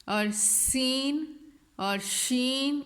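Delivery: سین and شین drawn out slowly with a hissing whistle; whistling sound. Shown as a demonstration. Teacher showing students the whistle in سین and شین, slow hiss. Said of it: "ان کی ادائیگی میں سیٹی کی آواز آئے گی".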